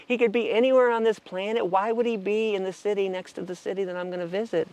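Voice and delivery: high, disbelieving plea to the sky; talking up to the heavens, stunned and pleading; incredulous to heavens. Retold or imitated. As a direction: protest to the universe in disbelief. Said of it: "I'm like".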